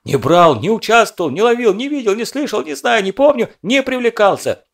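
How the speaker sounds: rapid babbling denial, rising high and loud; excited kid rambling. A narrator's reading. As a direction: rattle it off breathlessly, no pauses. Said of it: "затараторил без остановки".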